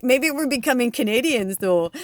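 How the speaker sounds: a light put-on Canadian accent, half joking; mock Canadian accent. Joking to her partner about turning Canadian, in a mock Canadian accent. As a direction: tease your partner in a playful Canadian accent. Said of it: "channeling, like, a Canadian accent".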